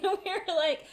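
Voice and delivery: normal talking voice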